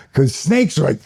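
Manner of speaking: ordinary delivery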